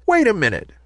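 high-pitched, mildly surprised. A narrator's reading. Ballerina realising a grown-up talks to her, surprised. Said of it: "said the ballerina in surprise".

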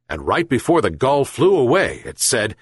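ordinary delivery

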